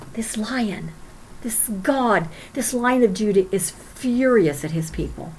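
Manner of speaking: soft, whispered start sinking into a low hush. Acted. Preacher warning his people of God's fury, hushed. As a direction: lean in and hush the congregation.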